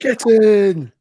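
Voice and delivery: high, loud terrace yell; mock fan shout. Hamming up a terrace celebration with a high, loud yell.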